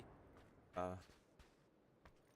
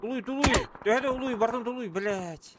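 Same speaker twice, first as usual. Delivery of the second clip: shouting; 10 semitones higher, 5 dB louder than usual. Real, not acted. Yelling at teammate during fight.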